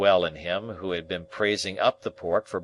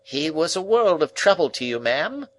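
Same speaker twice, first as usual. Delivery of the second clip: much higher, sympathetic neighbour; commiserating; 9 semitones higher, 5 dB louder than usual. Acted. Neighbour commiserating with the sister, high and pitying. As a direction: soothe the sister with pity. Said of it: "commiserating my sister".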